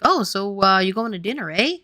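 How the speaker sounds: loud, hammed-up Canadian with a big eh; mock Canadian accent. Mimicking husband's parents, a Canadian accent. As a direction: imitate the in-laws' Canadian eh. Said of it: "they would just be like".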